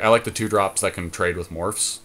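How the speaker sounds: ordinary delivery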